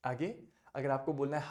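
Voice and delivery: ordinary talk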